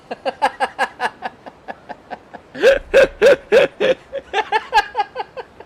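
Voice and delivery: very high, loud shrieking laughter; long high-pitched cackle. Real, not acted. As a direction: crack up hard. Laughs at the line.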